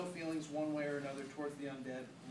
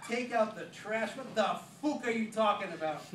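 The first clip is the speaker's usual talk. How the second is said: loud, higher, incredulous; outraged disbelief; 7 semitones higher, 9 dB louder than usual. Acted. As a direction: blurt it in shocked outrage.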